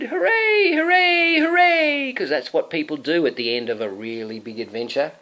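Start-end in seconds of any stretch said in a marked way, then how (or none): 0.0-2.1 cheering. Acted. Crowd cheers at end.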